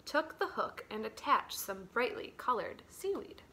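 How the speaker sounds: ordinary delivery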